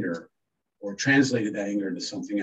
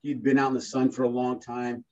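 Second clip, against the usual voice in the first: ordinary delivery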